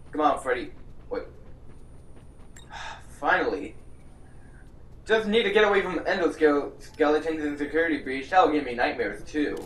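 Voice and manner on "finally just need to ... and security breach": usual speaking voice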